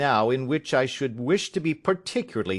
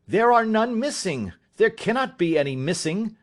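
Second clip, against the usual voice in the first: heated denial; 8 semitones higher than usual. Acted. Son denying in a quarrel.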